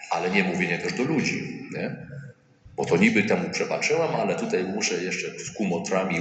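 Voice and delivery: ordinary delivery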